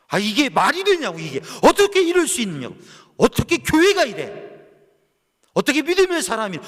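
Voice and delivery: loud, high indignant outcry; voicing the congregation's outrage, full force; outraged preaching. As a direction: let the disbelief burst out from the pulpit.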